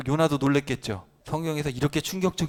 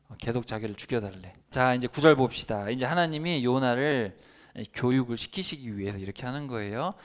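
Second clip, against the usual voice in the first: normal talking voice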